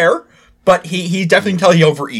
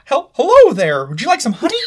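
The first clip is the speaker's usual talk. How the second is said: Winnie the Pooh; 7 semitones higher than usual. Acted. Game master greets a player as the honey bear, high cartoon voice. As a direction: attempt a storybook bear, pitched high. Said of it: "I can't do the poo voice".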